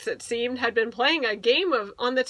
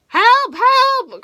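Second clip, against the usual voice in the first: very high, loud panicked squeal; talking chair shrieking as Goldilocks sits; frantic chair crying for help; 12 semitones higher, 11 dB louder than usual. A narrator's reading. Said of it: "cried Horace the chair".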